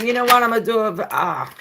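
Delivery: loud, high, fast, gleeful; excited self-quote. Retold or imitated. Quoting herself, exuberant.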